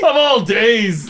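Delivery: slow, drawn-out mock shout. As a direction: shout it in fake outrage. Mock outrage at vintage card.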